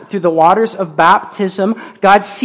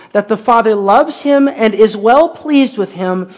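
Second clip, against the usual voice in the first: usual speaking voice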